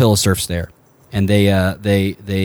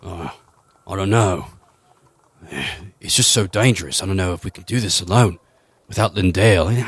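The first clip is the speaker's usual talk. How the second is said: Stinky fretting; 2 semitones lower, 5 dB quieter than usual. Acted. Stinky doubting the attack without Lindale, softer and worried. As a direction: hang back and fret quietly. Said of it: "you hear Stinky go".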